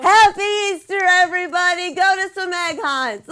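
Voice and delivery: loud, high festive shout; excited shout. Real, not acted. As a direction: call it out cheerfully to everyone. Loud festive sign-off to viewers.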